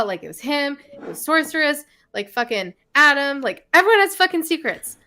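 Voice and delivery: rising from exasperated to incredulous; building rant. Retold or imitated. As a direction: let the frustration build.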